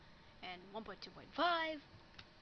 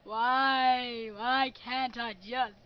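frustrated wail; 10 dB louder, much slower than usual. Real, not acted. Gamer despairing over controls.

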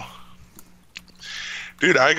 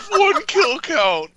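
incredulous; 10 semitones higher than usual. Real, not acted. Disbelief at first-kill drop.

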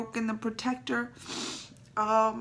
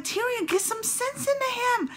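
much higher, begging Tyrion; high pleading plea; 4 dB louder than usual. Retold or imitated. Fan begging Tyrion to talk sense into him, high plea. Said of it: "and I'm like".

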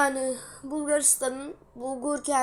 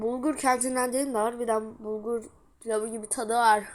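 usual speaking voice

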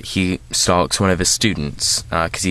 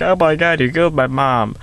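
higher, hammed-up wail of grief; mock bawling; 8 semitones higher than usual. Viewer imagining himself as the daughter, mock bawling. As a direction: pretend to bawl in horror. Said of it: "I would be bawling my eyes out".

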